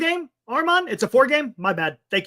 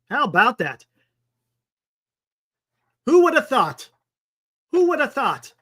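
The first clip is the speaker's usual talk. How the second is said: sarcastic; 3 semitones higher than usual. Acted. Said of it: "I'm being sarcastic".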